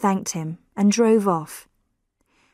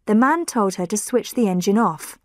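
ordinary delivery

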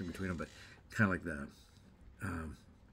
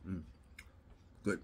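speaking between bites; chewing while talking; 4 dB quieter than usual. Real, not acted. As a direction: mouth partly full of cake. Seen 0: Mouth working after a bite, hand at lips, cake held.

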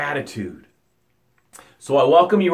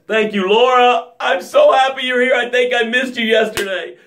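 excited greeting; 8 semitones higher than usual. Real, not acted. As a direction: shout a delighted welcome. Greeting a viewer by name.

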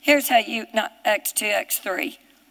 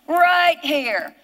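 emphatic, punched pulpit declaration; impassioned preaching; 4 semitones higher, 3 dB louder than usual. Real, not acted. Preacher telling congregation the trouble is herself, forceful declaration. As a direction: drive it home as a confession. Preaches about own failing.

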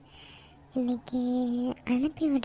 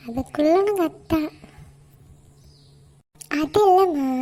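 sharp raised protest; loud denial; 3 semitones higher, 7 dB louder than usual. Acted. (உரத்த மறுப்பு)

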